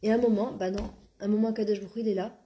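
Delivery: ordinary talk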